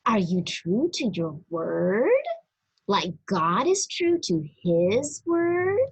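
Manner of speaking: plain reading voice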